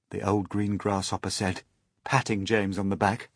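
ordinary delivery, nothing marked

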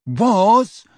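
an unexpected, loud-edged mutter. A narrator's reading. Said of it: "muttered Dudley unexpectedly".

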